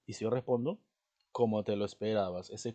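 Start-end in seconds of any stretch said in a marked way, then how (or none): 1.3-2.5 loud falling answer, "Como" not questioned; respuesta afirmativa. Shown as a demonstration. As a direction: state it firmly, dropping at the end. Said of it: "es una afirmación de una respuesta". (afirmación fuerte, "Como" sin tono de pregunta)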